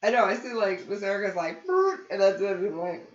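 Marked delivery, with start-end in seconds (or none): 1.6-2.0 a high, loud imitated shiver, brr; mock shiver brr. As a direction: shiver out a teasing brr like Erica. Imitates Erica stirring cold.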